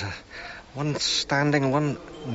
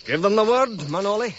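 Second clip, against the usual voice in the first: 1 call out; 6 semitones higher, 4 dB louder than usual. Real, not acted. Orders start of dig.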